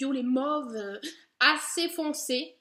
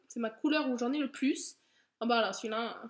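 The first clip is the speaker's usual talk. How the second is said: ordinary talk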